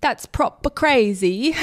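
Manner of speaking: playful slow Australian accent. Shown as a demonstration. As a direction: show off an Australian accent with "proper". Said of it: "talking in Australian accents".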